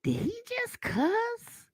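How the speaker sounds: high, hushed, slow disbelieving question; stunned disbelief. Retold or imitated. Mother to her son after the cashier cussed, hushed high shock. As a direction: squeak it softly, aghast, as an aside.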